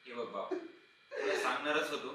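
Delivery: low, soft, slow explanation; calm him down gently; subdued placating. Acted. Master placates after the outburst.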